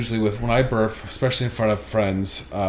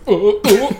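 disgusted cries; 9 semitones higher, 7 dB louder than usual. Retold or imitated. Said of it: "someone's like".

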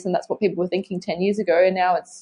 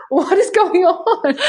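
high, slow, laughing through the words; laughing disbelief; 10 semitones higher, much slower than usual. Retold or imitated. Retelling her date's packed lunch, laughing in disbelief.

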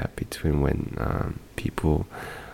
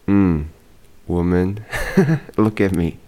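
louder, playful show of attitude, laughing; mock-sassy comeback; 4 semitones higher, 6 dB louder than usual. Imagined retort to customs lady, laughing mock sass. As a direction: puff up in a joking retort.

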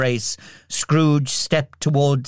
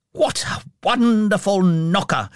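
exclaimed; 6 semitones higher than usual. A narrator's reading. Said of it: "he exclaimed".

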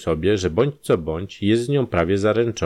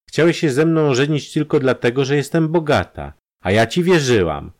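higher, building to a louder reproach; hurt and indignant, pitch climbing; bitter accusation; 7 semitones higher, 4 dB louder than usual. Acted. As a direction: accuse the fiancé with mounting indignation. Acted quarrel, bitter accusation.

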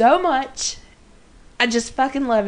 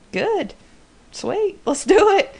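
mock breezy Dean; 8 semitones higher than usual. Voicing Dean's flippant reply.

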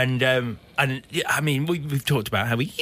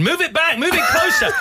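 high, louder panicked calls; mock frantic yelling; 11 semitones higher, 4 dB louder than usual. Producers panicking in his ear, mock yelling. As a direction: shout into the earpiece in a panic. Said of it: "people screaming in my ear".

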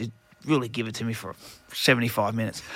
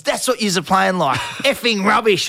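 higher, louder mock angry coach; mock coach's spray; 7 semitones higher, 4 dB louder than usual. Coach Matty Elliott tipping out the bin at half time, yelling. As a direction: dress the team down for playing badly. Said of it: "And he goes".

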